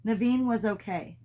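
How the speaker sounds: usual speaking voice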